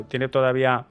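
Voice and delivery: usual speaking voice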